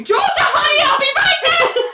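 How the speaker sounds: high, loud calling-out voice; mock shout. Ali shouting sweetly to Georgia, loud mock call. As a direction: call across the office, hammed up.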